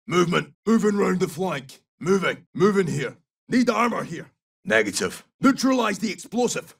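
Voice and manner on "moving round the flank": ordinary delivery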